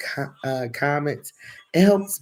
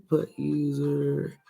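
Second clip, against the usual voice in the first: ordinary talk, nothing marked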